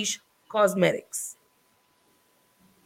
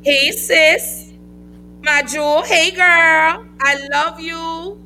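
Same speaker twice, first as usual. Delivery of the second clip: excited greeting; 7 semitones higher, slower than usual. Real, not acted. Greets her friend from the comments.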